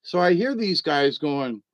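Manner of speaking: usual speaking voice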